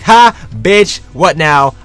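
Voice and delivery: high, loud triumphant jeer; loud mocking crow of victory; gloating taunt. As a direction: rub it in her face, loud and cocky. Gloating text to girlfriend.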